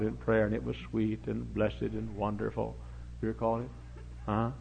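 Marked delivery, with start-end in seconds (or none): none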